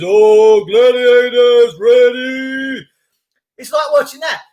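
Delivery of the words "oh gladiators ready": loud, drawn-out game-show call; imitation arena shout, stretched slow; Gladiators announcer. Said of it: "You remember Gladiators?".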